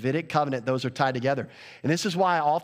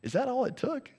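amused; 8 semitones higher, 4 dB quieter than usual. Retold or imitated. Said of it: "slightly amused by this response".

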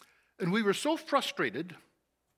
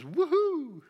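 excited whoop; 8 semitones higher than usual. Retold or imitated. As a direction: whoop like a man escaping a mess. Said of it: "He says".

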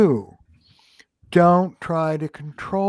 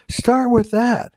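emphatic; 7 semitones higher, 3 dB louder than usual. Real, not acted. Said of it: "emphatic point, pitch up".

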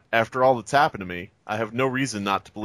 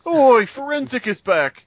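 higher, louder, slow cockney-style Oi; mock British call; 11 semitones higher, 6 dB louder, slower than usual. Announcing a returning joke with a mock British call. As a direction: shout it like a London heckler, playfully.

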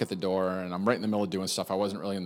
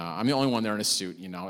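ordinary delivery

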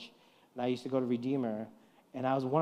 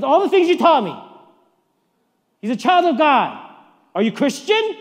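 much higher and louder, indignant; child scolding loudly; 12 semitones higher, 16 dB louder than usual. Retold or imitated. Son loudly reproaching his father in front of passengers, high indignant shout. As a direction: throw his own teaching back at him, full voice.

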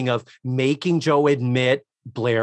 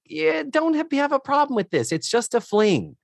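put-on much higher, airy girlfriend voice; imitating Cynthia; 13 semitones higher than usual. Cynthia alone with Joe, high imitated dismissive voice. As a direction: put on a high-pitched, casual young woman. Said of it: "Cynthia's like".

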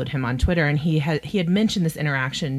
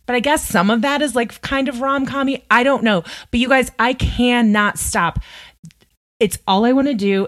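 higher and louder, bubbling over; can't contain the enthusiasm; excited gushing; 7 semitones higher, 5 dB louder than usual. Real, not acted. Podcaster gushing to listeners about her new obsession, excitedly.

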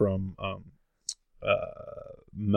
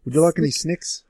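higher-pitched mock New Zealand accent; mimicked Kiwi accent; 7 semitones higher than usual. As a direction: ask it like a Kiwi, vowels pinched to "snicks". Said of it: "that's a Kiwi accent".

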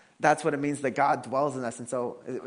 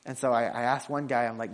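ordinary talk, nothing marked

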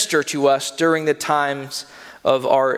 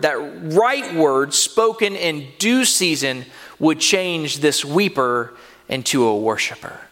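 usual speaking voice

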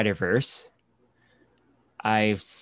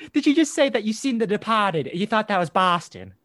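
much higher, fast, mock-outraged Boston drunk; angry Boston accent; 11 semitones higher, faster than usual. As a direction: fire back like an insulted local fan, fast and high. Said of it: "Oh, he was so mad".

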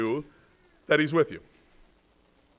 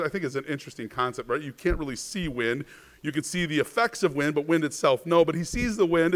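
ordinary talk

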